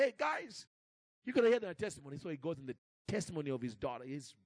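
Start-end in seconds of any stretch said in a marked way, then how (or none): 0.0-2.2 higher, louder call to the room; rally the group to listen, eager; excited call-out. Retold or imitated. Father announces to meeting.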